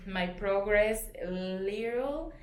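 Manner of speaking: ordinary delivery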